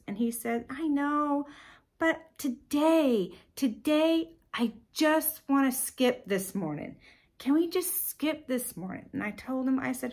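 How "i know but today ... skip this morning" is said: lightly voiced kid begging off; pleading son. Son begging mom to skip this morning, pleading.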